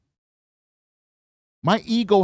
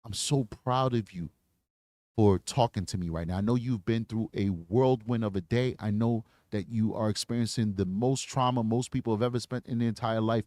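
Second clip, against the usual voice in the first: gentle, soft and low, a detective comforting a victim; reassuring detective, quieter and lower; softened voice; 5 semitones lower, 4 dB quieter than usual. Shown as a demonstration. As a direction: ease off and speak softly, like to someone hurt. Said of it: "I gotta soften myself".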